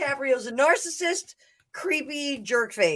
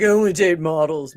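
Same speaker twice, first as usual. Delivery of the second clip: douchey guy voice; 8 semitones lower, 6 dB louder than usual. Retold or imitated. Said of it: "It's like".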